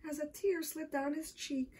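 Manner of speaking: plain reading voice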